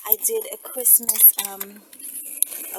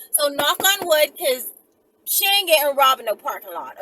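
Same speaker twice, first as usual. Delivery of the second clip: loud excited; 3 semitones higher, 8 dB louder than usual. Real, not acted. Knocking on wood against robbery.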